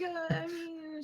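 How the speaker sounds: high, soft, sheepish backpedal; awkward little half-laughing voice; self-conscious. Acted. Gogo hides her claws after a stabbing remark, self-conscious. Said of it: "kind of self-consciously hides her claws".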